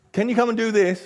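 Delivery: a wife calling for help; wife asking. Retold or imitated.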